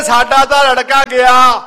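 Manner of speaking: loud, high, drawn-out mock wail; retold lament. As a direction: imitate a grieving family's cry, slowly. Family wails over the dead boy.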